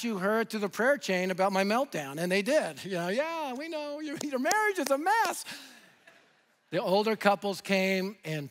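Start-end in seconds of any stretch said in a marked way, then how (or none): 3.1-5.4 imitated knowing congregants. Congregation replying about the pastor's marriage, imitated knowing chorus. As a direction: mimic them rising higher through the line. Voices congregation who heard the gossip.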